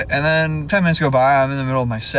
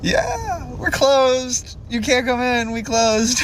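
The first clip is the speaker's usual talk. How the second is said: high-pitched mock female voice, sliding down; high mimicry of the girl turning him away; imitating gym girl; 7 semitones higher, much slower than usual. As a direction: quote her in a put-on high voice. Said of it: "She's like".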